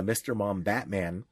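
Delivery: ordinary talk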